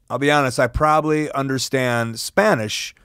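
normal talking voice

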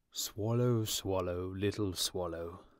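drawn-out, lightly voiced appeal; pleading statue prince. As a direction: call to him slowly, imploringly.

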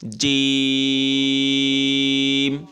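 জিম held out very long and loud, the vowel drawn out; stretched long. Shown as a demonstration. Teacher leading students to repeat after him: long stretched jim. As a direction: hold জিম for four to six counts. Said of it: "চার থেকে ছয় আলি পরিমাণ টেনে।".

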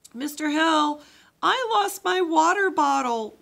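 high, loud wail, slow. A narrator's reading. Girl to the camp leader about her lost bottle, loud wail. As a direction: wail it like a kid who lost something. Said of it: "wailed Leonie".

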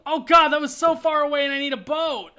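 real high, loud outburst of dismay; loud, high-pitched wail of frustration; frustrated yell. Real, not acted. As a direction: yell it high, upset at the game. Gamer reacts to disaster in game.

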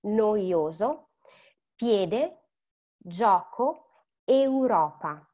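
spaced-out Italian words, each vowel sounded; all vowels pronounced. Shown as a demonstration. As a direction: model NOIOSO, PIEDE, GIOCO, EUROPA, vowel by vowel. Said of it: "I'm pronouncing all the vowels".

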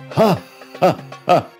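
high, separated laughs; staccato gloating cackle; villain's laugh. Acted. Magician finishing his evil wishes, gloating laugh.

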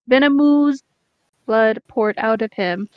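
normal talking voice

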